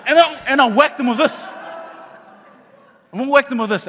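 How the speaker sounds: loud, high playful threat; mock threat. Preacher pretending to attack his trainer onstage, loud mock threat. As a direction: raise your voice as if about to strike, in jest.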